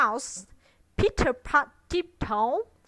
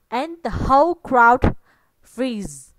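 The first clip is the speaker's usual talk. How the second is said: ordinary delivery, nothing marked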